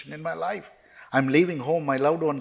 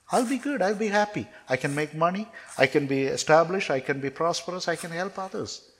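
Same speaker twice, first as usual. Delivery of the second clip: high-pitched bargaining, promise after promise; eager pleading; 5 semitones higher than usual. Retold or imitated. Jobless man promises God he'll be good, eager pleading. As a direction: plead your case in a raised pitch.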